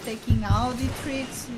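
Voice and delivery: ordinary talk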